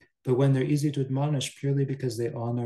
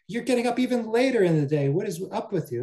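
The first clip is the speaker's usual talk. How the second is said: much higher, louder, quicker scolding; shrill scolding; 9 semitones higher, 4 dB louder, faster than usual. Acted. Mistress berates Kali for rising even later, in a high, loud, fast scolding voice. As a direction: berate the maid in a high, raised voice.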